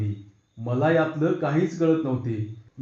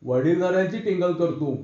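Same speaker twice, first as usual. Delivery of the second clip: plain reading voice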